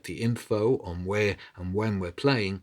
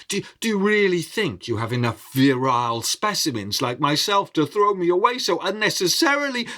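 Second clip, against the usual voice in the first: louder, building to a higher, faster frantic plea; desperate pleading; 5 semitones higher, 5 dB louder than usual. Acted. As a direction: talk fast to save your own skin. Man pleading against his burial.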